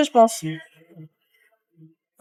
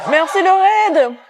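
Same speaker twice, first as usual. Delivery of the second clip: slow, high delighted thanks; light up and thank them slowly; excited thanks; 5 semitones higher, slower than usual. Real, not acted. Thanks viewers for a raid.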